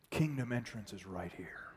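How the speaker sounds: hushed whisper. Real, not acted. Preacher whispers the point to the congregation. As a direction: breathe it out as a secret. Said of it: "preacher drops to whisper".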